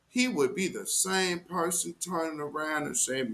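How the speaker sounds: ordinary talk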